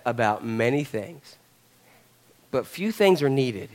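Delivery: low, soft and soothing; calm her down tenderly, voice dropped right down; gentle reproof. Acted. Acting Jesus' gentle response to Martha.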